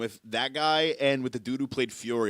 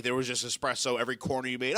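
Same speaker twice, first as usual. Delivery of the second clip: ordinary talk, nothing marked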